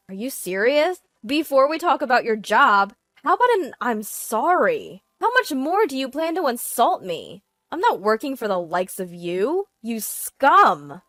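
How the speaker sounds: wife at the end of her patience; indignant scolding. Acted. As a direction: lay into your cheating husband. Wife loses patience, berates husband.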